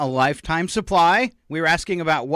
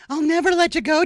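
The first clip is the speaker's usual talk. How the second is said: mock Rose; 13 semitones higher, 4 dB louder than usual. Quoting Titanic line.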